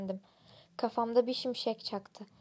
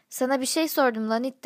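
angry demand; 3 semitones higher, 10 dB louder than usual. A narrator's reading. Narrator threatens the beaten barman.

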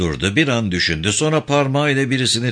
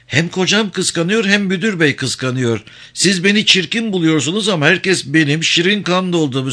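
higher-pitched woman's voice; self-admiring wife, pitched up; vain, complaining wife; 6 semitones higher than usual. Acted. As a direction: boast and complain in a lifted voice.

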